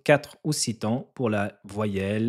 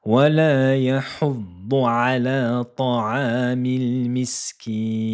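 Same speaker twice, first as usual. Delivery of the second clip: Quran recitation; 3 semitones higher, 4 dB louder than usual. Shown as a demonstration. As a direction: recite the verse in melodic chant.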